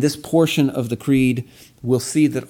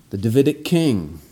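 ordinary talk, nothing marked

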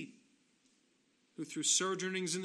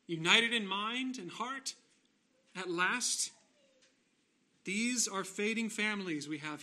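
lifted, swelling pulpit delivery; impassioned preaching; 3 semitones higher than usual. Real, not acted. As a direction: preach it with punched key words and measured pauses. Preacher builds to a climax.

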